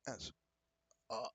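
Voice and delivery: ordinary talk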